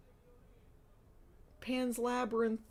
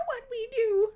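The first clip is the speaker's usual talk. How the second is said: squeaky high voice; 8 dB louder than usual. Acted. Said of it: "Shiraz whispered".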